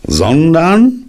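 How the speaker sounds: high, loud, held-out question; loud, demanding one-word question; startled questioning. Acted. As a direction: stretch it out and hang high on it. Donkey asks anxiously about his tail.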